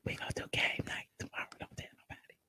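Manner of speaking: secretive whisper. Pretend. Secret plan told to viewers.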